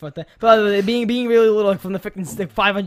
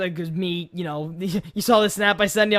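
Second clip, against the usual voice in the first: usual speaking voice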